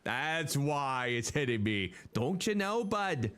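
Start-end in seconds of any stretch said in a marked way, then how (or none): 2.1-3.3 put-on Midwestern accent. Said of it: "She has that Midwestern accent".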